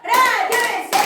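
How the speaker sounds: 신나게 박수 박자 세기; counting aloud. Shown as a demonstration. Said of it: "박수 세 번". (크게 세는 구령)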